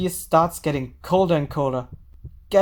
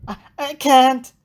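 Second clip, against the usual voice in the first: panicked; 8 semitones higher, 6 dB louder than usual. Acted. Senri panicking.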